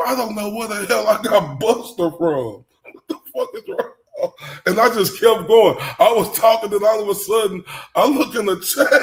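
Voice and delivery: high, loud, laughing through it; ranting while cracking up. Real, not acted. To viewers about mixing up Buster, high laughing rant. Laughing retelling own blunder.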